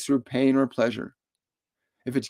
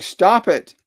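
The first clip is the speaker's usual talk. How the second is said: blunt order; 6 semitones higher, 7 dB louder than usual. Retold or imitated. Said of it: "He's just saying".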